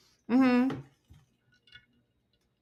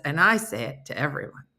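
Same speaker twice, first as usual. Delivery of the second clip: ordinary talk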